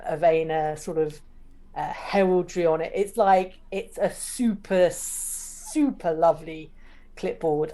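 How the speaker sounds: usual speaking voice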